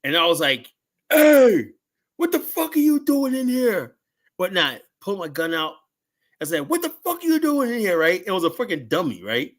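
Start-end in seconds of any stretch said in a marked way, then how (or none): 1.1-4.0 high, loud yell at an intruder; startled shout. Retold or imitated. Guard yelling at a figure in the garage, startled shout. 6.7-8.0 high-pitched yell at a figure; startled shout. Retold or imitated. Guard challenging the intruder again, high yell.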